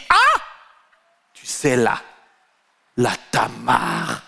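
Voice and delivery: mock gossip, high shrieks and a shout; gasp, then gossip slow, then scream the count; shrieking gossip. Churchgoer gossiping to Judas about Tamar, mock shrieking.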